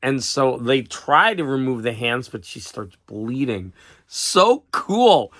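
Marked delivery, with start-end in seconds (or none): 4.0-5.3 higher and louder, savouring it; real glee; delighted. Real, not acted. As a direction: relish the creepy twist. Relishing the gruesome ending.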